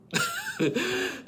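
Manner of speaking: very high-pitched laugh; real squealing laughter. Real, not acted. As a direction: burst out laughing. Cracks up after the recap.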